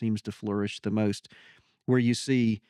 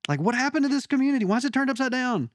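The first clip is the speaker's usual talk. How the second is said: excited; 9 semitones higher than usual. Retold or imitated. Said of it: "And I'm like".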